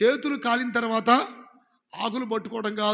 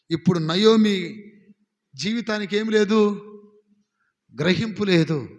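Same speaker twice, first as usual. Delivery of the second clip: emphatic preaching; 6 dB louder than usual. Acted. Preaching at full force.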